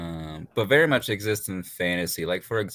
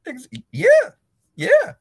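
excited high yeah; 10 dB louder than usual. Retold or imitated. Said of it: "I'm like".

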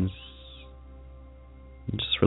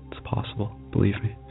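whisper; 6 dB quieter than usual. Acted. Reassuring aside within meditation.

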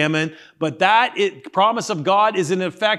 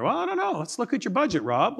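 lightly voiced friend, pitched up and softer; friend's reply; 5 semitones higher, 5 dB quieter, faster than usual. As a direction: play a friend mulling over the problem, higher. Acted friend answering about money.

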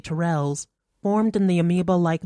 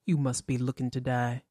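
quiet threat; 5 semitones lower, 6 dB quieter than usual. A narrator's reading. Benjamin threatens Natalie.

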